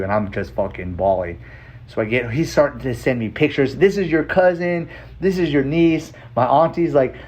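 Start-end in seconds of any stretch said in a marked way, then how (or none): 3.8-6.1 bright, raised voice showing off photos; eager family pride, louder and higher; excited introductions. Retold or imitated. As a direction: announce each relative with joy. Relatives sending family photos.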